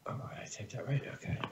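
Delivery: unvoiced whisper to yourself. Real, not acted. Checking his own typing while others wait, real whisper. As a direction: breathe it out to yourself, half-surprised.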